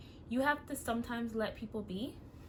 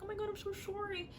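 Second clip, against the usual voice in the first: mock teddy bear apology; 10 semitones higher, 5 dB quieter than usual. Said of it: "the teddy bear's like".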